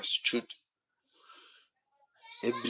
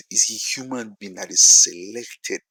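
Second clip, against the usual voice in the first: "selected" punched louder; stress on selected; 2 semitones higher, 7 dB louder than usual. Shown as a demonstration. Preacher defining a prophet for the congregation, stress on "selected". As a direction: hit the word "selected" with extra force. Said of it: "Emphasis on the word selected".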